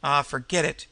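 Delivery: ordinary delivery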